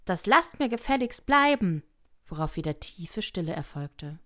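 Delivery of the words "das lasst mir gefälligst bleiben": high, loud scolding shout; indignant shout. A narrator's reading. Alice orders the stone-throwers to stop, shouting out. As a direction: shout out to make them stop. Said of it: "schrie hinaus".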